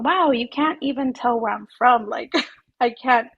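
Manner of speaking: ordinary delivery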